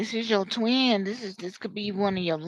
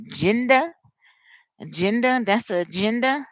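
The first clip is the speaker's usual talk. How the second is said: ordinary talk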